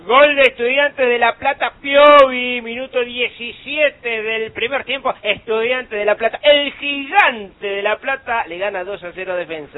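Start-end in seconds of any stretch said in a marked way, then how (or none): 0.0-8.5 loud, high goal shout; goal call. Acted. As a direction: sing out the goal like radio.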